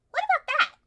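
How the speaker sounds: loud, high excited call. Acted. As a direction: call out the find eagerly.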